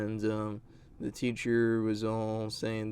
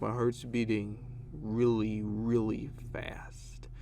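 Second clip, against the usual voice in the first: imitating a slurred, dragging drunk; slurring; slower than usual. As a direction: draw the words out slowly, mimicking a woozy classmate. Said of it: "talking that way and slurring a little bit".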